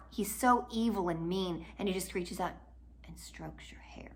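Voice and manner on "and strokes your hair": much softer, whispered aside; creepy whisper. Real, not acted. Narrator drawing listeners into the creepy moment, whispered. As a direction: breathe it out with disgust. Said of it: "just imagine this".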